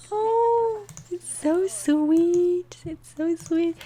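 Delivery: high slow aww; gushing. Real, not acted. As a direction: melt over how sweet it is. Charmed by a sweet moment.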